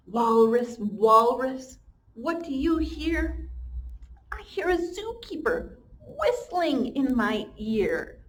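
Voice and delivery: sing-song slow storybook read; reading to children. Acted. Teacher reads the refrain aloud to her class, slow and sing-song. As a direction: chant the refrain for little ones.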